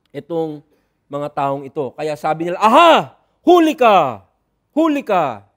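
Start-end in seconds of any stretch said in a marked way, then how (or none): 2.6-5.5 loud gotcha cry, catching someone out; accusing shout. Acted. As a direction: pounce on them like a Pharisee: caught you. Pharisees accuse the disciples. (pasigaw na nanghuhuli)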